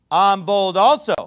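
loud, high, declaimed boast; foolish boasting. Acted. Preacher reading Paul's mock boast to the congregation, loudly boastful. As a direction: punch each answer out proudly and emphatically. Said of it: "He does some foolish boasting".

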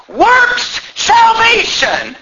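high, drawn-out denouncing shout; preacher's shout. Real, not acted. Preacher denouncing the perverted gospel, real shout. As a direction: thunder it out, word by word.

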